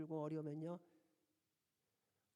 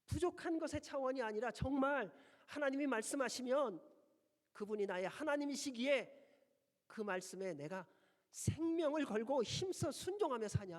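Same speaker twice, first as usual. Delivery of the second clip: fervent preaching; 8 semitones higher, 10 dB louder than usual. Acted. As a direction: hammer each phrase home from the pulpit. Preaching at full force.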